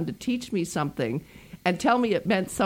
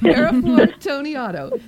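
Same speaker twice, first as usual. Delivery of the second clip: slow, higher, louder Italian flourish on the name; hammed-up Italian accent; 6 semitones higher, 9 dB louder, slower than usual. Host announcing the guest's name in a hammed-up Italian accent. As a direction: stretch out the name with a big playful Italian accent. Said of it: "Now I'm gonna do it in my Italian accent".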